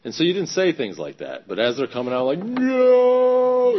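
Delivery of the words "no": long, high, loud drawn-out no; mock panicked cry. Trying to get his words back, mock panicked wail.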